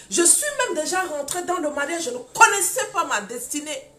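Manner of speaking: loud, driving sermon delivery; full-force preaching. Acted. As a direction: preach it to the back row.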